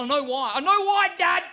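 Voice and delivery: rising to a high loud shout; building outburst. Retold or imitated. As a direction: lose it at dad on stage. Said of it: "I just had to be honest".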